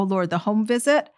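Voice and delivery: ordinary talk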